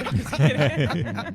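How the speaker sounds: slow, laughing it off. Real, not acted. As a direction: laugh through the take-back. Laughing off joke.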